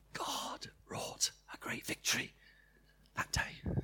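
hushed whisper of awe. Real, not acted. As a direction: breathe it out in a whisper. Climax of testimony.

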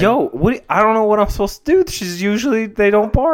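high, loud, flustered defence; loud high-pitched exasperation; flustered protest. Real, not acted. Flustered defence of the barking dogs.

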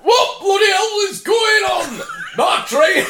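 high, slow, straining Yorkshire shout; hammed-up Yorkshire driver in agony; Yorkshire accent. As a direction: push out each word in broad Yorkshire. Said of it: "give me that line again".

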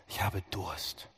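viel tiefer, leiser und langsam; leise, matt. Acted. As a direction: sprich es erschöpft und leise. Jesu Durst, leise zitiert. (tief und leise, erschöpft)

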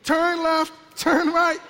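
high, held notes, mock navigation voice; imitated auto-tune GPS. God as navigation app guiding you, mock auto-tune. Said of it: "God is your Waves app telling you".

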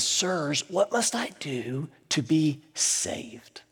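slow hushed plea; trembling and afraid. Retold or imitated. Terrified jailer begging Paul and Silas, hushed and slow. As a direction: ask it slowly, almost breathless with fear. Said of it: "trembling and afraid, and he says".

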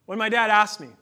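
ordinary talk

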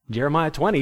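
usual speaking voice

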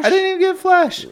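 squeaky high, loud, fast indignant echo; outraged disbelief. Real, not acted. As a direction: blurt it out in outraged disbelief, voice jumping way up. Echoes friend's question in disbelief.

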